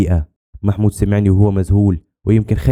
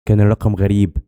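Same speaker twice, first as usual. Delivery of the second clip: usual speaking voice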